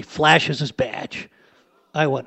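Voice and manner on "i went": normal talking voice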